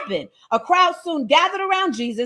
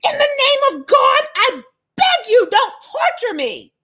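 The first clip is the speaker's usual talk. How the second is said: high, pleading demon cry; possessed man begging, higher and half crying; demon screaming; 10 semitones higher than usual. Acted. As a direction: beg Jesus like a tormented spirit, near tears. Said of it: "he screamed".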